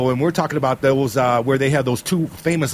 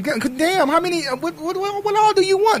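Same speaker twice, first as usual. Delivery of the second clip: climbing higher in disbelief; rising exasperation, voice going up; exasperated; 14 semitones higher than usual. Real, not acted. Host ranting about [name] getting another degree, exasperated climb.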